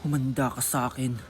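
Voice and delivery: put-on low vengeful mean-girl vow; villain threat. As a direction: growl a threat like a soap-opera rival. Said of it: "Ako ang main character".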